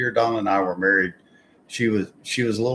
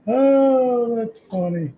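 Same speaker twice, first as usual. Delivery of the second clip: laughing through pain; 12 semitones higher, 4 dB louder, slower than usual. Real, not acted. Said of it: "it hurts to laugh".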